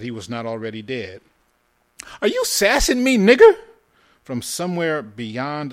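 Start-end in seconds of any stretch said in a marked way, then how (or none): 2.2-3.6 put-on very high, loud challenge from a rattled boy; high and shaky. A narrator's reading. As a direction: shout it high, as if short of breath. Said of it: "high and shaky, as if he could not suck in enough breath".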